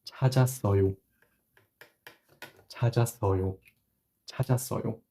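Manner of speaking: 찾았어요 said clearly, syllable by syllable, three times over; model pronunciation. Shown as a demonstration. Teacher modeling 찾았어요 for students, careful and clear. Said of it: "now you can attach the ending".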